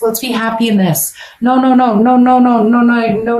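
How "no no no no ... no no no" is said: loud no no no. Acted. Shooing away negativity.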